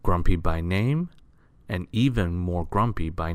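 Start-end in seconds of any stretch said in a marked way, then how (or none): none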